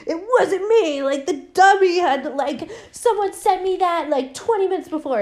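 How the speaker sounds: hammed-up British accent; mock British accent. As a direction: protest innocence in a silly British accent. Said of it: "his accent keeps sleep-, slipping".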